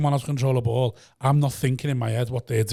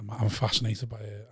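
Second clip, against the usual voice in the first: usual speaking voice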